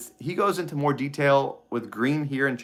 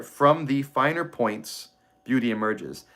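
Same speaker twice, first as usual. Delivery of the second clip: slow, weighty reading of the line; slow deliberate reading; slower than usual. Shown as a demonstration. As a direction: let each word land slowly. Rereads the underlined line.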